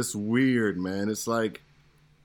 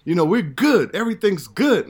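higher, pleased and satisfied; smug contentment; 8 semitones higher, 4 dB louder than usual. Retold or imitated. Actor retelling his old self to hosts, smugly content. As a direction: tell yourself all is well, grinning. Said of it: "My wife did hair".

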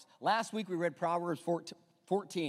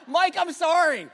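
sorry through a laugh; giggling mock apology to a friend; 10 semitones higher, 10 dB louder than usual. Real, not acted. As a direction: apologise while still laughing, louder and higher. Laughing apology after naming Mike.

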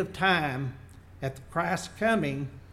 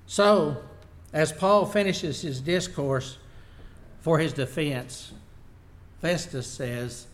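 ordinary delivery

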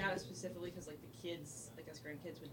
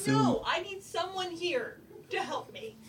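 playful pleading; 8 semitones higher, 12 dB louder, much slower than usual. Pretend. Boss begging him to stay.

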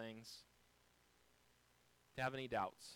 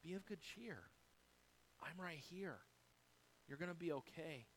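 much softer, close and comforting; tender reassurance; 3 semitones higher, 9 dB quieter than usual. Retold or imitated. The Lord comforting someone overwhelmed by life's storm, softly. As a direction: hush a worried friend gently. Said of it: "And the Lord's like".

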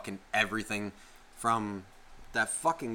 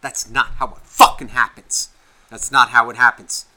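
yelling; 9 dB louder than usual. Real, not acted. Host loudly insists it's not how it happens. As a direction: hammer it home loudly. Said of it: "I'm just fucking yelling".

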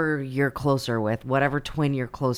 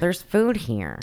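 mock nerd voice; 4 semitones higher, slower than usual. Mocking the nerd's question.

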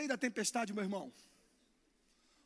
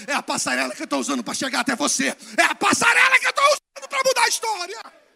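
rising to a full preaching shout, higher and louder; building shout; 7 semitones higher, 16 dB louder than usual. Real, not acted. Pregação em crescendo. (gritando cada vez mais alto)

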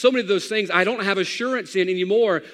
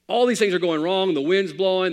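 usual speaking voice

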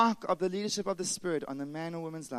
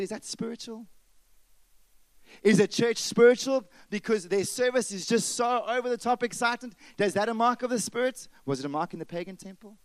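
a touch of Paul challenging, higher pitch; voicing Paul; 5 semitones higher, 4 dB louder than usual. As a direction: ask it as Paul would, needling. Voicing Paul's challenge, heightened.

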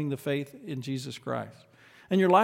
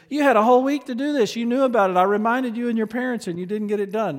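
raised, fast scolding teacher; a pastor scolding a pupil, louder and faster; stern scolding; 6 semitones higher, 5 dB louder, faster than usual. Retold or imitated. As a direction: scold her for undone homework. Pastor scolding student in story.

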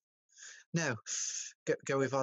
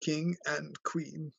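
slow priest; 2 semitones higher, 3 dB quieter, much slower than usual. Acted. Priest finishing the ceremony.

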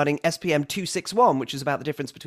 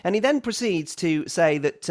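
ordinary talk, nothing marked

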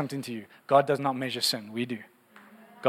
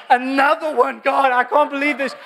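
high, loud, laughing exasperation; amused complaint to God; mock weary groan; 10 semitones higher, 5 dB louder than usual. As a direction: sigh it out to God, half laughing.

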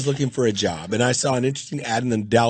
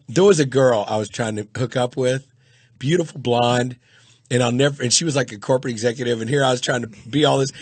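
normal talking voice

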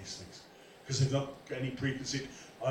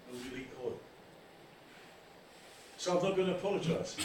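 usual speaking voice

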